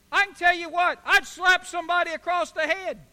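loud, high mock-outraged shout; indignant outburst. Preacher reacting to a rebuffed "I love you", indignant shout.

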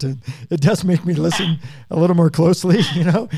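laughing lightly through the words; amused chuckle. Real, not acted. Host to guest about her accent, amused chuckle. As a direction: let a real chuckle lift the line.

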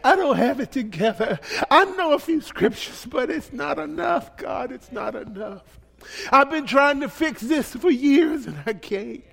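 a touch of pleading confession; acted prayer. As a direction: admit need to God, plainly. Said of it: "people who say".

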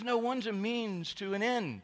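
high, loud, slow smug correction; mock know-it-all. Trendy friend correcting the pastor, high smug mock voice. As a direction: catch him out triumphantly. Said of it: "He says".

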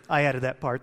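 usual speaking voice

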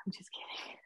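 whispered, throwaway take-back; hushed aside. Real, not acted. Taking back a cheeky question to friends, whispered aside. Jokey aside after asking if cute.

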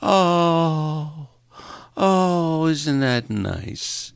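high, slow, gushing coo; cooing crowd. Retold or imitated. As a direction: coo it like doting dog owners. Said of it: "everybody goes".

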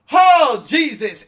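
sudden high loud yelp of surprise; startled shout. Real, not acted. Host suddenly startled mid-episode, shouting in surprise. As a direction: jump and cry out.

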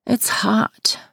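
high little whine. A narrator's reading. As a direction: complain in a high, mild moan. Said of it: "she moans".

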